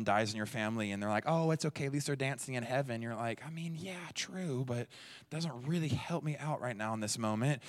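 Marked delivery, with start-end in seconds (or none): 1.3-3.0 high-pitched, sugary mock comfort; lightly acted chirpy condolence; glib consoling. Well-meaning people consoling the bereaved. 3.5-7.6 higher-pitched, tentative acted reply; mildly acted awkward disagreement; hesitant polite objection. Mourner answering unhelpful comfort politely.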